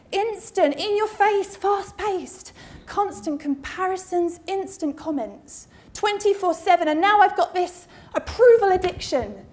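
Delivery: usual speaking voice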